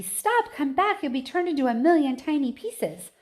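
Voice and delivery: high, loud frantic plea; screaming plea. A narrator's reading. Mother screams at her son to come back. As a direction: beg him to come back. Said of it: "screamed Missus T V".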